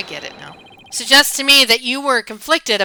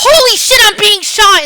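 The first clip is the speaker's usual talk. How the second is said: much higher and louder, a frantic yelp; panicked shock; 11 semitones higher, 12 dB louder than usual. Shown as a demonstration. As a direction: scream it as the bullets fly. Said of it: "instead of".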